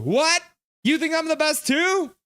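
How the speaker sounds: hammed-up shock at being praised; mock surprise. Streamer hamming up surprise at a chat compliment.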